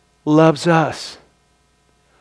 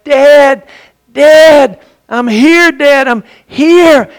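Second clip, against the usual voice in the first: high, loud relieved shout; screaming back; 9 semitones higher, 6 dB louder, much slower than usual. Retold or imitated. Lost boy in the swamp answering his dad, shouting. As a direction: yell back so dad can find you. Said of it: "screaming back".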